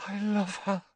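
high, soft, breathless swooning. A narrator's reading. Lovestruck Ron repeats his confession, breathlessly. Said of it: "repeated Ron breathlessly".

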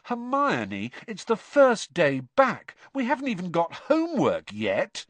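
can't believe she wants the library; incredulous. Acted. Ron incredulous at Hermione.

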